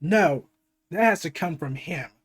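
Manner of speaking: ordinary talk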